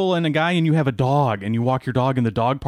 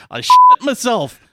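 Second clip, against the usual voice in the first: high, loud, laughing outburst; laughing shout; 12 semitones higher, 10 dB louder, slower than usual. Real, not acted. Host shouting through laughter at his co-hosts.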